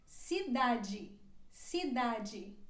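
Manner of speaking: cidade with the final de said like "dji"; model word. Shown as a demonstration. Teacher models cidade for learners. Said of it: "como se fosse uma I".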